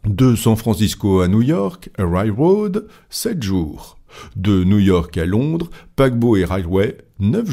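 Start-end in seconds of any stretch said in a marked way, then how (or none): none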